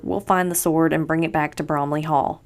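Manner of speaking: ordinary delivery, nothing marked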